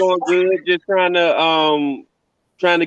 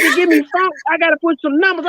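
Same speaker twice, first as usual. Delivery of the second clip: imitating mama; 10 semitones higher, faster than usual. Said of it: "She was like".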